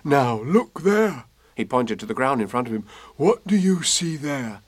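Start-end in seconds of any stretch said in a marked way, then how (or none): 0.0-1.2 lightly voiced bear, slow; puzzled teddy bear; mild Pooh voice; slow Pooh musing. 3.2-4.6 lightly voiced bear, slow; puzzled teddy bear; mild Pooh voice; slow Pooh musing.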